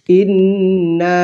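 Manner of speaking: ইন্না with the final "na" stretched for three alif counts; long madd. Shown as a demonstration. Teacher showing learners the three-alif stretch, drawn-out ইন্না. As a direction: hold the long "aa" ending of ইন্না, evenly. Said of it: "তিন আলিফ টানতে হবে".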